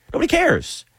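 cares jumps up high in exasperation; exasperated high nobody cares. Real, not acted. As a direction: throw it up high, fed up with the gatekeepers. Repeats it, mocking gatekeeping fans.

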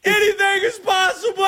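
all-out, high-pitched victory scream; Kevin Garnett. Acted. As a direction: scream it to the rafters, stretching the last word. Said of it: "You gotta say".

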